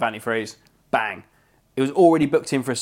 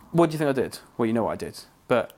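ordinary delivery

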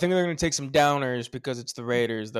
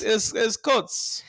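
put-on German accent; 7 semitones higher, slower than usual. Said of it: "Give me your best Hitler accent".

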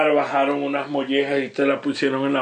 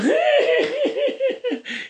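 very high, prolonged laughing fit; high giggling cackle in imitation; imitated laughter. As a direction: mimic a friend who can't stop laughing. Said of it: "y se reía, y reía, y reía".

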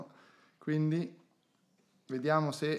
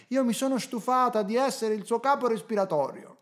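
lady's voice; 8 semitones higher than usual. Retold or imitated. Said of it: "una signora ha detto".

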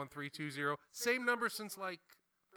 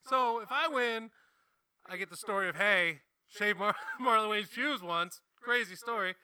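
excited; 7 semitones higher, 11 dB louder than usual. Retold or imitated. Excited retelling of the stakes.

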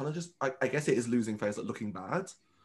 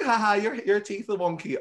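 light tease; 8 semitones higher, 5 dB louder than usual. Retold or imitated. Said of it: "or be like".

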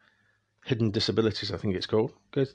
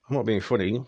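ordinary talk, nothing marked

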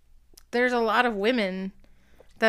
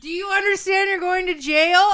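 higher and louder, slightly laughing, bullying; mock stern yell; 9 semitones higher, 5 dB louder than usual. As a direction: press the threat hard. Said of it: "And I was like".

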